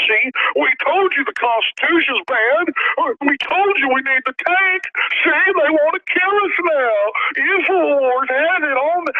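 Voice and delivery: high, slow, whiny mock police voice; mock whiny cop. Host mocking police claiming victimhood, high and whiny. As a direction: play the cop as a whining fake victim.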